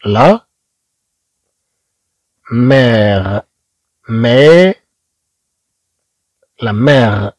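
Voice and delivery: لا and مير split with long pauses; syllable by syllable. Shown as a demonstration. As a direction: break لا مير into slow pieces. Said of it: "إذا نقول".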